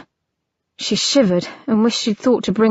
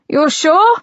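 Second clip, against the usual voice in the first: calling out; 7 semitones higher, 5 dB louder than usual. Acted. Brother calling through the door.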